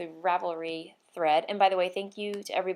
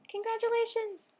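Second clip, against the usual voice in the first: bright gleeful congratulations; high excited cheer. Real, not acted. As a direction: squeal it much higher in delight. Cheering the winner.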